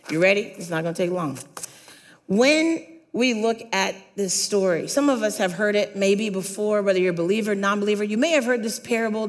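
ordinary talk

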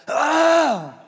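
high, loud throat-clearing yowl; loud cat-like hack. Real, not acted. Pastor clearing phlegm before the congregation, cat-like yowl. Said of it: "I really need to sound like a cat".

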